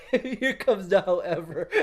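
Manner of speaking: chuckling through here comes the however; teacher laughing before criticism. Real, not acted. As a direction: laugh while teasing the student.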